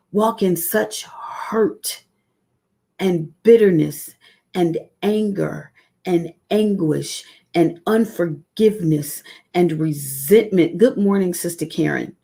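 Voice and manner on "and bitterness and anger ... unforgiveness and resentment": ordinary talk, nothing marked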